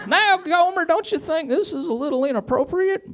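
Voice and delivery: very high, squeaky protest; Barney squeaking. A narrator's reading. Barney objecting to Gomer's advances, high squeak. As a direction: squeak it like a flustered deputy. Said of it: "Barney squeaked out".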